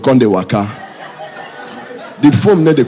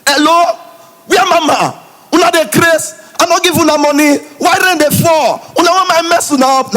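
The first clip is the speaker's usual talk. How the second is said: angry man's voice; 14 semitones higher than usual. Acted. Man on the phone shouting at the caller for the rain. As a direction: scold the caller in a squeaky put-on man's voice.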